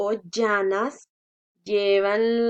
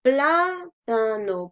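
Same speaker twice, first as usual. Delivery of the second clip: plátano drawn out, "PLÁ-tano"; slow teacher's demo of plátano, strong opening syllable; first-syllable stress; much slower than usual. Shown as a demonstration. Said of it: "porque no decimos".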